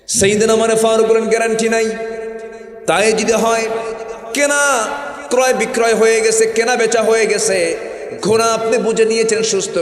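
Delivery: high, loud preaching cry; preaching at full force. Acted. Preacher crying out to the congregation in a loud sermon. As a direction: rise up and preach it hard.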